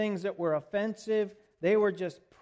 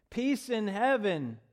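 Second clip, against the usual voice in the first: loud praise; 3 semitones higher, 7 dB louder than usual. Acted. Crowd praising Jesus as he arrives, loud praise. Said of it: "Boy, they sang and praised".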